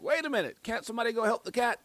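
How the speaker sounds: pleading for someone to check on the cat; incredulous. Retold or imitated. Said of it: "I'm like".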